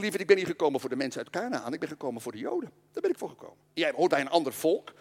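a hint of a tender Jesus; gentle Jesus. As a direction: let him down gently, like a friend. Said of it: "zei Jezus heel aardig, heel attent".

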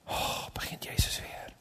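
whispered sighing complaint; weary whisper. Acted. Peter thinks to himself about Jesus asking, weary whisper. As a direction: whisper it like a weary private thought.

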